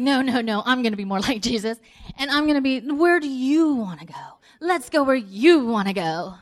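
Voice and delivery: loud, insistent mock piety; competitive selflessness. Wife outdoes her husband in being like Jesus, loudly insistent.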